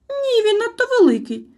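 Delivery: very high, loud little protest; protesting squeak. A narrator's reading. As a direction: protest like a small guilty creature. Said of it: "запротестував Чмих". (обурений писк)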